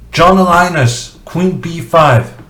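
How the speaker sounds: praising the suggestion; excited. Real, not acted. Excited praise of viewer's move.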